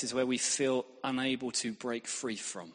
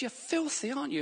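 taunting devil; 13 semitones higher than usual. Retold or imitated. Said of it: "And he goes".

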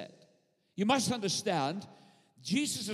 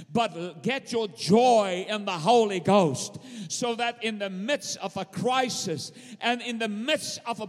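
full-force preaching; 2 semitones higher, 5 dB louder than usual. Acted. Preacher rallying the church to keep its joy, full-force preaching. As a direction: build to a ringing hallelujah.

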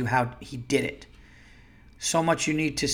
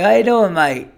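loud, high, hammed-up Aussie greeting; corrected Australian accent, big and loud; 6 semitones higher, 9 dB louder than usual. Shown as a demonstration. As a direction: punch it out in broad mock Australian. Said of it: "It's more like that".

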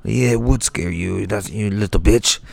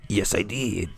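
ordinary talk

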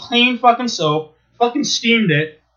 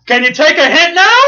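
high, louder sarcastic challenge; mock chef jab; 9 semitones higher, 7 dB louder than usual. Chef taunting pizza owner after taste test, loud mock jab. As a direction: needle him loudly. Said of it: "he goes".

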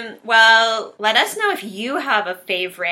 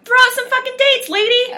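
much higher, louder playful yell; mock outburst; 6 dB louder than usual. Friend yelling at co-host to pick movie dates, mock angry. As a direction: bark a joking demand at your friend.

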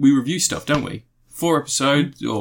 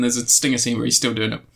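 ordinary talk, nothing marked

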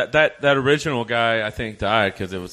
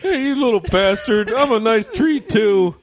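high, loud indignant tree; hammed-up furious Christmas tree; angry tree; 12 semitones higher, 3 dB louder than usual. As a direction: yell back like an insulted tree. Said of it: "Christmas tree".

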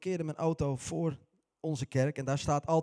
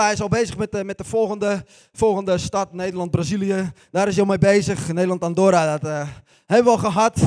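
louder, cocky, full of himself; loud mock boasting; 2 semitones higher, 10 dB louder than usual. Preacher voicing Jozua boasting to the church, loud and cocky. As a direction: crow about the next easy win.

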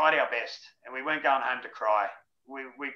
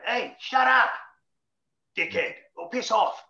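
higher, louder mock yell, last words slow; rough coach yell; 10 semitones higher, 4 dB louder, slower than usual. Old-fashioned coach yelling at chatty players, louder mock yell. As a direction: shout it down at the rowdy players. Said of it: "Chris Anderson going".

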